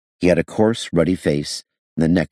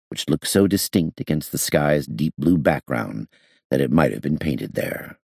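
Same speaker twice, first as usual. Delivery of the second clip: ordinary delivery, nothing marked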